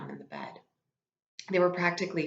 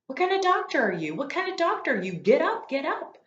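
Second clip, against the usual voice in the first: much higher, louder furious yell; yelling nurse; 11 semitones higher, 4 dB louder than usual. Acted. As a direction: scold the fainted doctor at full pitch. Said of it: "yelling at him the whole time".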